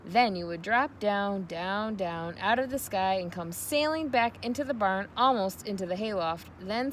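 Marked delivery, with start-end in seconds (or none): none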